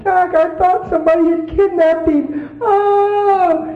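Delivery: high wailing imitation; mock crying. Wife telling husband she feared a kidnapping, high wail. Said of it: "she was crying her eyes out".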